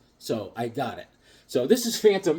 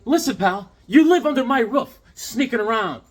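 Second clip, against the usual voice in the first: mock scolding; 8 semitones higher, 6 dB louder than usual. Mock argument on phone.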